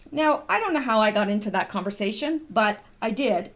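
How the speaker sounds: ordinary talk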